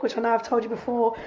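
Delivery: ordinary talk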